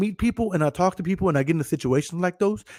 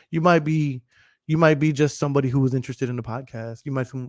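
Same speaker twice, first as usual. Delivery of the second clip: ordinary talk, nothing marked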